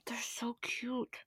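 slow, hushed, voiceless coo; adoring whisper. Real, not acted. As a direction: whisper it as if too moved to speak. Gushing over the two leads.

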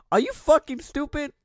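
high, loud mock-incredulous snap; retold indignant outburst. Retold outburst at passive bystander.

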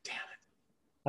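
muttered under breath, voiceless; whispered curse. Real, not acted. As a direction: whisper it in mock disappointment. Disappointed about the turkey.

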